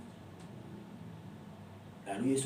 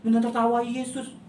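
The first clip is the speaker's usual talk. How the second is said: ordinary talk, nothing marked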